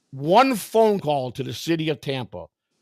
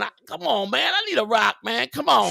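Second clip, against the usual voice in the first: screaming crackhead; 6 semitones higher than usual. Retold or imitated. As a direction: imitate an addict pleading for a fix. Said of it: "He comes screaming at the fucking top of his lungs".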